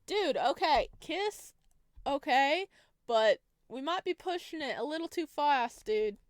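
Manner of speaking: loud, high startled protest; flustered protest. Real, not acted. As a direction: blurt it out, caught off guard. Reacting to stay invitation.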